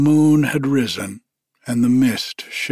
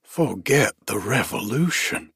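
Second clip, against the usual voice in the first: ordinary delivery, nothing marked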